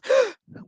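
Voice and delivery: sudden sharp gasping inhale. Shown as a demonstration. Teacher demonstrates the wrong breath, a sharp gasp. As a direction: suck the air in with a loud gasp. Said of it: "we go like this".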